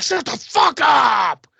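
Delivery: slow, high, loud snapping shout; mock furious shout. Psycho dad shouting at his kids, hammed-up rage. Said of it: "he's like".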